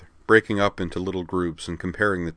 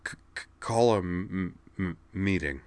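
stuttered order, anger held in; tight with anger. A narrator's reading. Kate telling her friend what to do, tight stammering anger. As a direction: stammer hard on the opening consonants. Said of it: "her voice still tight with anger".

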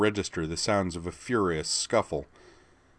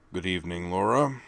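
rumbling. Acted. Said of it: "he rumbled".

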